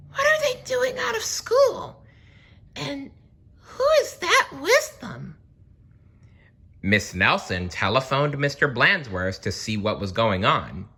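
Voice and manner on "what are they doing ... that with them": very high scratchy squawk. A narrator's reading. Miss Nelson spying the kids outside, high scratchy alarm. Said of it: "she said in a scratchy voice".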